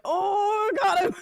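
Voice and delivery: high-pitched crowd "oh!" at a burn; crowd reacting. Audience reacting to her jab at Mike, imitated high jeer. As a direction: imitate a crowd whooping at a put-down. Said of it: "people were like".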